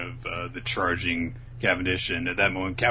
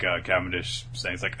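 usual speaking voice

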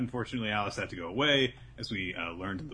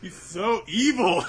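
appalled; 12 semitones higher, 9 dB louder, slower than usual. Real, not acted. Reacting to evil scene.

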